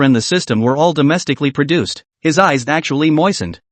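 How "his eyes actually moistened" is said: usual speaking voice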